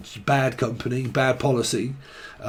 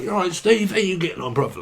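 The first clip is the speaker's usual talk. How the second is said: higher, bouncy impression of the boss; old boss greeting; 7 semitones higher than usual. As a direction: imitate a big lad greeting you. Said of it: "He normally shakes me to death".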